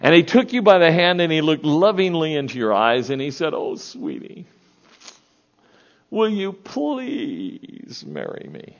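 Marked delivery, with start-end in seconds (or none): 3.5-8.8 soft breathy start, then high, slow and tender; hushed loving marriage proposal. Acted. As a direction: plead gently like a man on one knee. Husband's proposal acted out.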